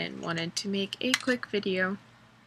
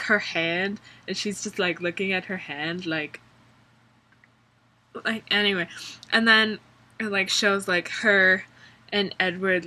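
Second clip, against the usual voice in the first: ordinary talk, nothing marked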